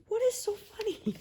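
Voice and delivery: high, puzzled voicing of him; curious husband. Husband asks what's so funny, high mock-puzzled. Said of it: "he comes in like".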